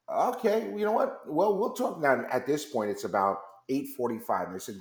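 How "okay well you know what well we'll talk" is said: much higher, hesitant mock reply; imitated control room producer, voice pitched way up. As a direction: play the uneasy producer on the line. Control room reacts to delay.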